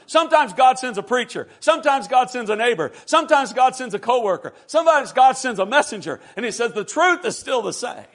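forceful, rhythmic sermon delivery; impassioned preaching. Real, not acted. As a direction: drive each repeated phrase home.

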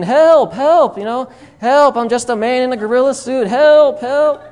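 high, loud panicked cries of help; screaming for help. Retold or imitated. Man in a gorilla suit screams for help at the lion. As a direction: yell for help like he's about to be eaten. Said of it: "He starts screaming".

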